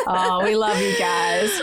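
real giggle spilling into the words, slowed; laughing through words. Real, not acted. Signing off to listeners, still laughing. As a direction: say it while still laughing, a little choked up. Emotional end of podcast chat.

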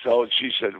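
ordinary talk, nothing marked

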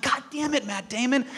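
mock frustration. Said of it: "And I'm like".

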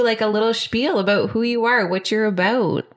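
ordinary talk

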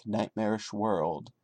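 normal talking voice

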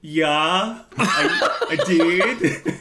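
high put-on voice cracking into laughter; bluffing fake voice.

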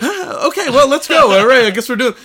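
high, loud, mock-flustered recovery; hammed-up awkwardness. Caller filling dead silence on the phone, mock flustered. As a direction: push loudly past the awkward silence.

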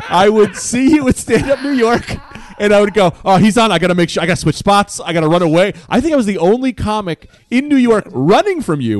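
loud, high, laughing exasperation; worked-up confession. Real, not acted. Comic confesses to another that he hid for years, worked up. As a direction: build it up as an absurd panic.

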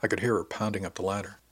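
ordinary delivery, nothing marked

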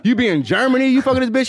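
usual speaking voice

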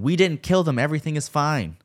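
very high, loud frantic yell. A narrator's reading. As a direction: yell it out into the night.

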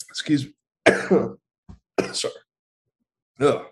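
choking coughs after water went down wrong; hacking, spluttering cough. Real, not acted. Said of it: "water went down the wrong pipe".

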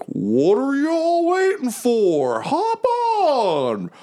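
very high, drawn-out squeaky character voice; rally them in a stretched-out high voice; Lulu. Acted. Flying mammoth calls friends to hop on, high excited holler. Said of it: "And Lulu's like".

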